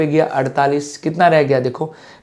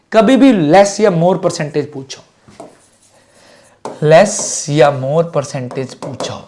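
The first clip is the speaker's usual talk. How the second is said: slow rule for memory; slower than usual. Shown as a demonstration. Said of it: "याद रखना हमेशा".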